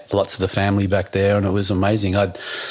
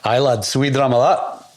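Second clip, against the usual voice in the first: quick broad Scottish accent, higher; 4 semitones higher than usual. Shown as a demonstration. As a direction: put on a Scottish brogue on request. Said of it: "how good's your Scottish accent? Can you do one?".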